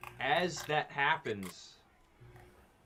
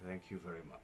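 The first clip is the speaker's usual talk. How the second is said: low quiet gruff thanks; 7 semitones lower, 12 dB quieter, much faster than usual. Acted. NPC thanks Kepri.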